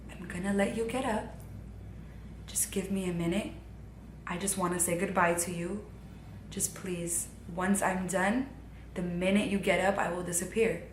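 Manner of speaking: tender soft-spoken goodbye; sweetest softest voice. Retold or imitated. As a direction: coax her gently, barely above a breath. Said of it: "in the most sweetest voice, softest voice like this".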